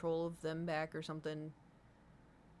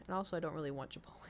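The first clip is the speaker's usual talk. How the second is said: whispered aside; 3 semitones lower, 6 dB quieter, faster than usual. Real, not acted. Quick whispered aside to chat.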